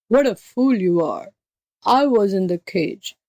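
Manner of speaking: scornful beast voice; impatient tiger. Acted. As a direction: put the jackal right as an exasperated tiger. Tiger figure corrects the jackal.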